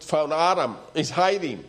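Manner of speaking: usual speaking voice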